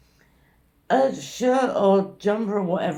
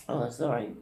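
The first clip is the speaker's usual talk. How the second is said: ordinary talk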